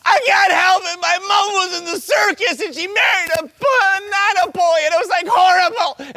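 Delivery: wailing and half-shouting, hammed-up tears; mock crying meltdown. Shown as a demonstration. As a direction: play the hurt victim, bawling it out. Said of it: "I'm gonna react to it".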